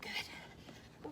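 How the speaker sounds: breathy whisper of praise. Real, not acted. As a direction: whisper it softly.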